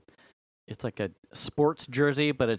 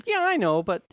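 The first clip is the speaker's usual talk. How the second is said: high, defensive whine; 9 semitones higher, 3 dB louder than usual. Retold or imitated. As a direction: protest up high. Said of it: "and I went".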